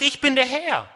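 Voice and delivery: God thumping the table; forceful declaration. Acted. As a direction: lay down the law as God. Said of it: "Gott haut hier richtig auf den Tisch".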